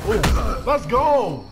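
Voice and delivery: loud, high rallying cry; getting hyped. Real, not acted. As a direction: psych yourself up mid-fight. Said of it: "I don't know why I'm getting hyped".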